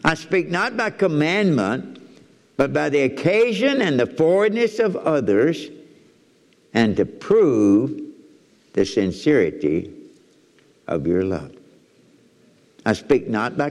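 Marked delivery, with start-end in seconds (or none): 0.0-5.7 high, solemn reading of the verse; scripture reading. Acted. Rereading the verse aloud. 6.8-11.6 slow, pausing between phrases; drawn-out emphasis. Acted. Dwelling on each phrase.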